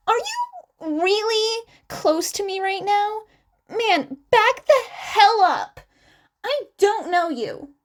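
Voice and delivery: high, rough, aggressive bark; inner Bakugo. A narrator's reading. Izuku barks at the clingy fan like Bakugo. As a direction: channel a hothead telling him off. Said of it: "whip out his inner Bakugo".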